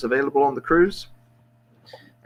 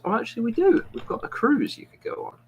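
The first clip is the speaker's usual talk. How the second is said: travel agent in a much higher, airy voice; 13 semitones higher than usual. Retold or imitated. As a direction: squeak it out like a chirpy clerk. Joke, travel agent finds cruise.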